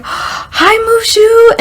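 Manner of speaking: very high, airy gushing greeting; squeaky re-enacted excitement; fangirl greeting. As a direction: coo at an adored dog, imitating your starstruck self. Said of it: "literally, I went like this".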